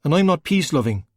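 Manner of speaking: indignant insistence; insisting. A narrator's reading. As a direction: push back hard, defensive. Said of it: "Ryan insisted".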